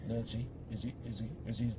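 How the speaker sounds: very soft, low nervous murmur; husband whispering nervously to Nancy; frightened whisper. Retold or imitated. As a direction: whisper to your wife without moving your lips.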